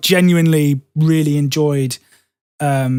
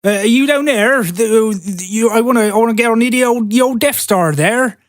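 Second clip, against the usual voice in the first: higher, rustic West Country burr as Vader; West Country Darth Vader; 6 semitones higher than usual. Retold or imitated. Imagining Darth Vader on set, in a West Country accent. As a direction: play Vader as a cheery West Country farmer. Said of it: "That's what he would have sounded like".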